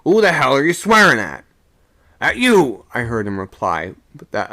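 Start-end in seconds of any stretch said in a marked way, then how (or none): 0.0-1.4 higher and louder, indignant; a furious sailor hurling back the curse; angry retort. Acted. Quarrel aloft in rigging. 2.2-2.9 high, loud snarl; a furious sailor's two-word retort; angry shout. Acted. Quarrel aloft in rigging.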